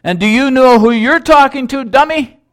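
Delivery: loud, very high defiant retort; acted shout, throwing the challenge back; cheeky, high-pitched yelled comeback. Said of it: "The trainer shouted back".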